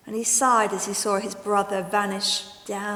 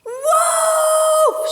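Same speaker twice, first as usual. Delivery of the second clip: high, loud cry of wolf; shouting; 9 dB louder than usual. Acted. Boy crying wolf to the village, loud shout. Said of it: "shouted a boy".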